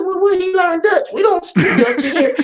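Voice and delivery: school staff voice. Retold or imitated. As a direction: play the baffled person on the school phone.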